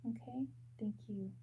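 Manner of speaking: a quick whispered okay off to the side; whispered aside. Real, not acted. Psychic reader whispers to someone off camera, whispering. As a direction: breathe it without voice.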